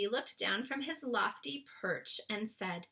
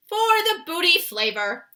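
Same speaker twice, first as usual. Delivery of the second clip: high, loud giant cry; BFG crying out; 9 semitones higher, 14 dB louder than usual. Acted. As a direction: shout the flavor gleefully as the giant. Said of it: "cried the B F G".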